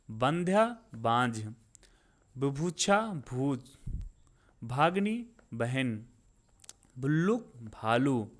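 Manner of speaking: spaced word pairs, each word set apart; unhurried vocabulary drill; slow, deliberate recitation. Shown as a demonstration. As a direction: take your time so learners can say it along. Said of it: "मैं बहुत आराम आराम से बोल रहा हूँ".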